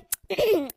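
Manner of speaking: soft, put-on throat clear; small self-important "ahem". Kid about to claim someone's answer, affected throat clear.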